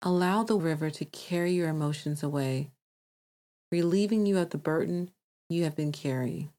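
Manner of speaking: calm meditation voice with long pauses; guided meditation. Acted. Host guides listeners to release emotions, calm meditation voice. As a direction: guide them gently, leaving space to breathe.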